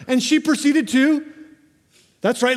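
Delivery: ordinary delivery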